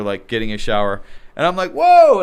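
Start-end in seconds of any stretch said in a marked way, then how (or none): 1.7-2.2 sudden high, loud startled cry; startled whoa. Retold or imitated. Walking in on Ronda, a shocked loud whoa. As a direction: blurt it out in shock.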